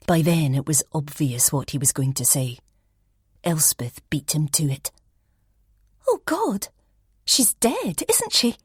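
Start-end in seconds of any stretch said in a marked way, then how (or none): 6.1-8.6 much higher, strained, rising; shocked guess. Acted. Elspeth to the detective, high and shocked. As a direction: blurt it out before he can.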